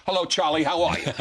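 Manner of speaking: a high, loud, laughing greeting from a forceful mother; booming mother. Retold or imitated. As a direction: boom it out like a strong mother. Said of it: "the table vibrates".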